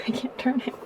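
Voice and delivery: whispered I can't turn it through a laugh. Real, not acted. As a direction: giggle it breathlessly. Laughing at failure.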